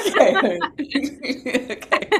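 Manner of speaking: laughing okay, giggling through it; chuckling while gathering herself. Real, not acted. Guest laughing after the joke.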